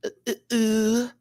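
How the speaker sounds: high rising ooh hoots; playful hoots. Pretend. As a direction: hoot along playfully. Playful hooting.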